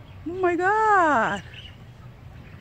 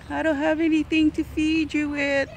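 usual speaking voice

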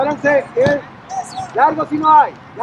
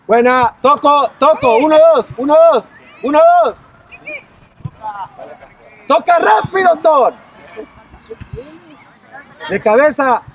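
shouting; 2 semitones higher, 6 dB louder than usual. Real, not acted. Coach counting passes, yelling.

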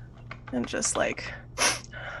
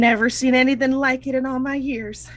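high-pitched, aghast character voice; aghast older lady; dismayed Ursula; 7 semitones higher, 4 dB louder than usual. Acted. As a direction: protest in bewildered disbelief, pitched up.

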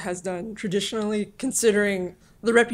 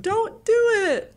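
high, slow singsong warning; drawn-out, high cartoon voice; cheery cartoon truck; 11 semitones higher than usual. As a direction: warn like a cheery animated truck. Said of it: "the cheery blue background with the animated truck".

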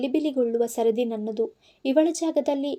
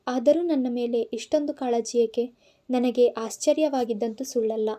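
plain reading voice

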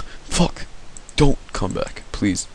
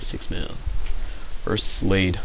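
ordinary talk, nothing marked

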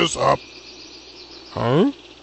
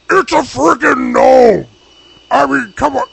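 exasperated shout; 9 semitones higher, 7 dB louder than usual. Real, not acted. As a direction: yell it in total disbelief. Disbelief at a gnome.

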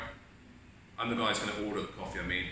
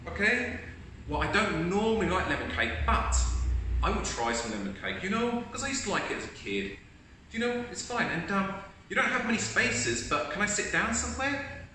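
higher, accepting; positive customer; 7 semitones higher than usual. Acted. Positive customer accepting lemon cake, higher and accepting. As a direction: make the best of it with a lift. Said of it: "show you how ... in a positive way".

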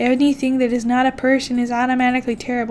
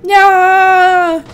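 higher, louder cheer; victory shout; 7 semitones higher, 10 dB louder than usual. Real, not acted.

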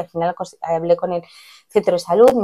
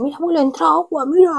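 put-on high, thrilled little boy showing grandpa; excited kid; 10 semitones higher than usual. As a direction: imitate the excited boy calling his grandpa. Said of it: "el tío emocionado".

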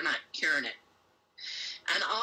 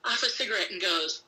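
normal talking voice

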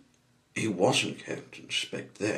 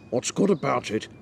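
loud, raised, irritable challenge; grumpy retort; 6 semitones higher, 8 dB louder than usual. A narrator's reading. Mister Grumpy answers a good morning, irritably. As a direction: challenge the greeting crossly. Grumpy man rejects the greeting.

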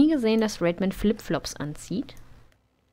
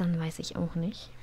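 whisper; 5 semitones lower, 8 dB quieter than usual. Real, not acted. Trailing aside.

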